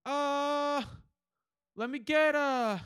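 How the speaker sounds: high, drawn-out mock drunk; dragging, high-pitched indecision; drunk customer. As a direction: imitate a drunk slowly trying to order. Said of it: "He's fucking shit-faced".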